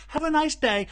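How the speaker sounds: high, loud mock-cheery shop greeting; mimicked American customer service. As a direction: imitate an overfriendly American shop worker. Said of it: "when you guys go".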